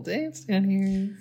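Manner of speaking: squeaky little playful voice; high silly voice. Pretend. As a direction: pitch it way up, teasing. Echoing the river dance joke.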